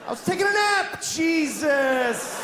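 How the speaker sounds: very high squealing shout; a flushed fish screaming in outrage; cartoon fish yelling in panic; panicked fish. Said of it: "it looks like he goes".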